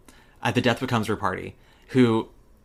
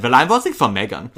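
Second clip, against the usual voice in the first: put-on German accent with v sounds, "Well" as "vell"; 5 dB louder than usual. Retelling the German voter's line, German accent. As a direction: imitate a German partygoer, laughing a bit. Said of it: "one German who said".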